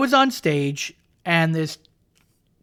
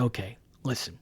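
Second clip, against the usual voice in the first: serious quiet turn; 10 semitones lower, 11 dB quieter than usual. Retold or imitated. Turning serious to the crowd.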